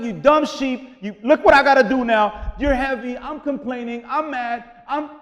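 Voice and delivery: loud, exasperated grumbling at a heavy sheep; pissed-off shepherd. Retold or imitated. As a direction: play the fed-up shepherd, complaining loudly. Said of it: "I'm gonna be pissed off".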